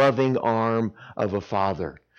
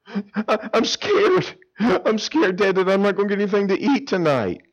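put-on frightened child; 7 semitones higher than usual. Child voiced crying to dad.

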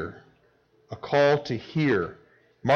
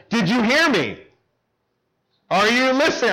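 raised pitch, demanding an answer; mock exasperated parent; 9 semitones higher, 4 dB louder than usual. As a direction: imitate a parent scolding an ignoring child.

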